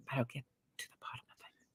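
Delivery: quick conspiratorial whisper, nearly voiceless; secretive aside whispered quickly to the viewers. Real, not acted. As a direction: lean in and whisper it fast like a secret. Conspiratorial aside about husband.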